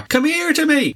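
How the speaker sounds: very high-pitched, hammed-up Dublin accent; mock Dublin accent. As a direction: squeal it like a parody Dubliner. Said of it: "Dublin accent".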